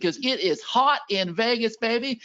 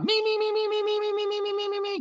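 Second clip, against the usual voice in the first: mock chorus of eager fans, way higher; squeaky chorus of me; 12 semitones higher than usual. Host voicing the chat crowd all calling out, squeaky high.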